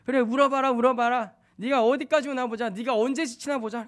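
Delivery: put-on fuming father daring the child to cry on; a dad losing patience with his crying son; angry. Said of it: "막 속에는 막 화가 나니까".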